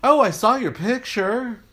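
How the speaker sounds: hammed-up thrilled sibling; excited sister. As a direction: imitate the sister's eager news. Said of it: "she said".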